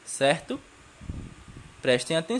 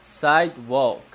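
slow model word; 4 dB louder, slower than usual. Shown as a demonstration. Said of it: "Could you repeat with me?".

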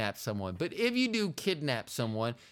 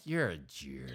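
low, quiet deadpan insult; acted low-voiced contempt; glaring retort; 13 semitones lower, 7 dB quieter than usual. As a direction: drop your voice into a withering mutter. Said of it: "I'll just glare at 'em and be like".

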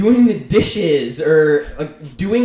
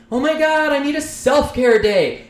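high-pitched, gushing mock voice; mocking dramatic poser; 10 semitones higher, 4 dB louder than usual. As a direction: parody someone fishing for pity online.

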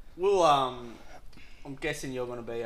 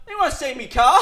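bewildered dude; 9 semitones higher, 7 dB louder than usual. Retold or imitated. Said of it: "walking around going".

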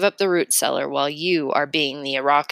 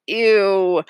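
disgusted ew; 2 semitones higher, 5 dB louder than usual. Acted. Pinching noses at dog pee.

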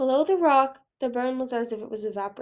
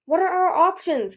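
high, louder, urgent question; frantic and raised in pitch; 8 semitones higher, 7 dB louder than usual. A narrator's reading. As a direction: demand answers in a panic. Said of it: "Kibbley said frantically".

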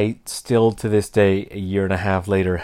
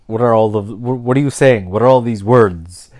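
loud, confused stammering questions; baffled listener; 7 dB louder than usual. Retold or imitated. Non-Canadian baffled by slang, loud confused questions. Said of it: "you'll be like".

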